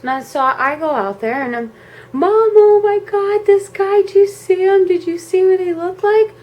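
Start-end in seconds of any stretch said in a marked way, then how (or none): 2.1-6.3 high, loud, urgent pleading; retold hysterical questioning; frantic kid, acted out. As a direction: blurt it in a panicked child's wail. Acts frantic child telling mom.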